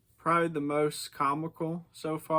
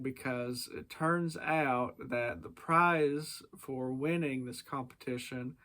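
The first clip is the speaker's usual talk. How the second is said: usual speaking voice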